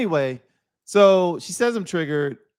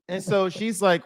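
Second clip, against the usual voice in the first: ordinary delivery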